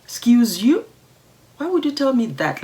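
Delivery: high, indignant talking-back; affronted comeback. Acted. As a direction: challenge the offender, pitch jumping up. Talks back to the offending colleague.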